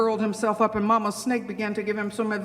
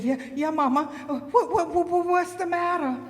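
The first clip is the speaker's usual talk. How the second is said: put-on anxious child; 6 semitones higher than usual. Child reacts to mother's shock.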